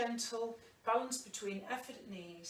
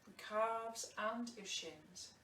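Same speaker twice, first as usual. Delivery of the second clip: guided meditation; 4 dB quieter than usual. Acted. Yoga teacher guiding the class through a body scan, soft meditation voice. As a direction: ease them into the scan, calm and unhurried.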